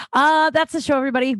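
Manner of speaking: bright outro shout-out; loud sign-off. Real, not acted. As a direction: announce the end of the show. Show sign-off.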